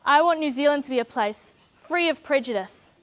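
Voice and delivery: ordinary delivery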